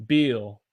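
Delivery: "Bill" as a long "beel"; Southern held vowel. Shown as a demonstration. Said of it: "it's longer".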